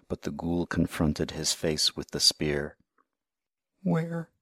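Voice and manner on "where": shivering. A narrator's reading. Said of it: "shivered the little man".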